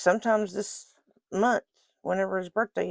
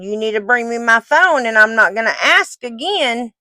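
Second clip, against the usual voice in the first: firm repeated order, louder; calm turning angry; 3 semitones higher, 8 dB louder than usual. Real, not acted.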